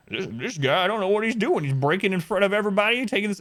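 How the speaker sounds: high, whiny mock voice; mocking accuser. The woman telling staff about him, in a high mocking voice. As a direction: parody her tattling tone.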